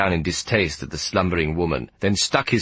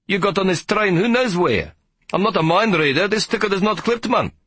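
scolding conductor; 12 semitones higher than usual. Acted. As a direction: scold the boy with indignation.

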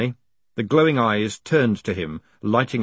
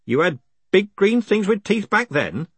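higher postman voice; 9 semitones higher than usual. Acted.

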